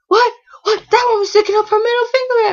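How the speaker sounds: high, loud, fast indignant imitation; outraged mom. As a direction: fire it off as an offended mother. Voices mom's outrage at gesture.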